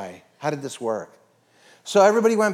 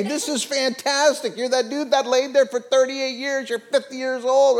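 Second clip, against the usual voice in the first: high-pitched excited gushing; acted amazement; 10 semitones higher than usual. What religious leaders could have said, acted amazement. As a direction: pour it out high and thrilled. Imagined leaders rejoicing over healing.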